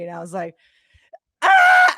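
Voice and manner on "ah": high shrieking squeal of joy; excited scream. Retold or imitated. Scream of joy on hearing she'll fight in the UFC. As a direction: let out a thrilled scream. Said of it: "but I didn't do it really".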